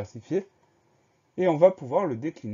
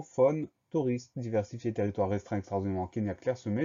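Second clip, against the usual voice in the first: quick recap. Shown as a demonstration. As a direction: rattle through the listed words. Quick recap of the word list.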